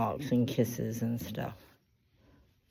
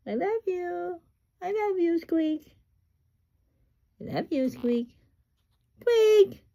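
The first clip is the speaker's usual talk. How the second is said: cooing pet talk. Real, not acted. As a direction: coo lovingly at a bird, high-pitched. Cooing to the parrot.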